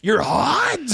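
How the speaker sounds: incredulous coach. Retold or imitated. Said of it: "He's like".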